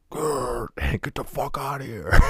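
high, growling mock shout breaking into a laugh; imagined adult yelling at a kid, growled mock shout. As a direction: snarl it out, half laughing.

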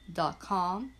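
ordinary delivery

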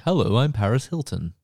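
ordinary talk, nothing marked

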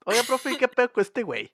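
voz de Harry mucho más aguda; Harry burlón agudo. Retold or imitated. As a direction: imita a Harry con tono alto. Harry encara al profe otra vez. (Harry le pregunta al profe, voz aguda burlona)

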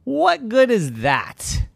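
loud, high mock laughter; hammed-up chuckling. Zeus mocking a neckless llama, hammed-up laughing. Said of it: "Big Zeus started chuckling".

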